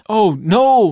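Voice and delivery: high, loud anguished cry; alarmed begging. A narrator's reading. Lot crying out to the angel in alarm. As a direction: cry out in dismay. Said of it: "Lot begged".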